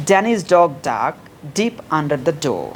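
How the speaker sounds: very slow, word by word; slow drill. Shown as a demonstration. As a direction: model it slowly for students. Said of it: "প্রথমে slowly বলবা". (ধীরে ধীরে বলা)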